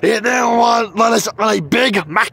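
shaking with anger; angry shout. Acted. Dad shouting to mom.

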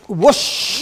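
breathy wind noise; listener's wind whoosh. Pretend. Listener copying the wind, whoosh. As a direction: try the wind sound. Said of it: "Let me try that".

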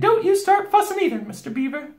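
high, loud chiding; Missus Beaver. A narrator's reading. Beaver wife chiding her husband, high housewife voice. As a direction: scold fondly in a high voice.

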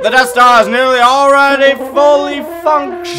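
loud breaking-news announcer voice; news anchor read. Acted. Friend reading the game crawl as a mock newscast. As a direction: deliver it like a breaking news bulletin.

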